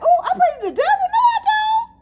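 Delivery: very high, loud laughing squeal; high falsetto mock denial. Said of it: "I'm like".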